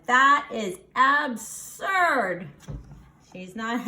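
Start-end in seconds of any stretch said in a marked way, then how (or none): 0.1-2.5 slow, loud scornful verdict; cranky Eugenia. Acted. Cranky neighbor scorns the piglet fuss, slow and loud. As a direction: drag out the scorn. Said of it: "Eugenia said".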